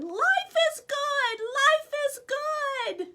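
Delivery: high slow joyful cry; joyful scream. Acted. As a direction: shout it with glee. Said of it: "screamed as they ran".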